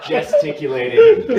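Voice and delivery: ordinary delivery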